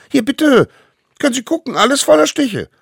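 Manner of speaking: very high, loud squeaky yell; defiant child. A narrator's reading. Smallest savage shows his bites to court, shouting. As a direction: present the proof shrieking like a cheeky kid.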